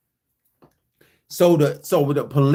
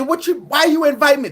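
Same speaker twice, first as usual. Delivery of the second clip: heated retort; 10 semitones higher than usual. Retold or imitated. Confronting her before police.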